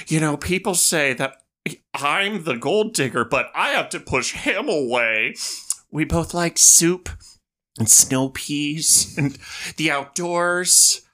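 imitating Jennifer Coolidge's character. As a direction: lightly impersonate a dreamy rich wife. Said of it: "She's like".

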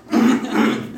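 sudden hard cough before speaking; coughing fit. Real, not acted. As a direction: clear the throat with a sharp cough. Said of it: "Peço desculpa pela tosse aqui".

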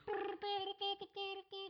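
high beeping periscope noise; playful mock beeps of a Star Wars periscope; robot beeping. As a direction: chirp like a tiny droid. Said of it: "makes the weird beeping noises like that".

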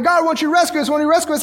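high-pitched acted plea; crying out. Israelites begging God to rescue them, crying out. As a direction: plead upward to God in mock desperation. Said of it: "They're crying out and saying".

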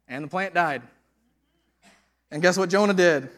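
loud, high preaching voice, storytelling Jonah with fire; preaching at full force. Acted. Preacher retelling Jonah to the church, loud and lively.

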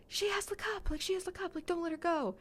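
high, urgent cry through a window; frantic shout. Retold or imitated. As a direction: yell a warning to a friend outside. Clary banging on the window.

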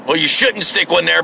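normal talking voice

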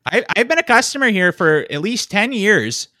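loud, high, hammed-up hurt customer; mock-wounded Minnesotan complaint; offended customer. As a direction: whine in protest as a wronged regular. Acted offended long-time customer.

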